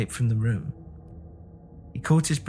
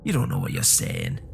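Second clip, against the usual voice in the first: much lower, unsettled protest; shaking voice. A narrator's reading. Husband to frantic wife, voice shaking. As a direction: protest in a low, unsteady voice. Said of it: "his voice shaking".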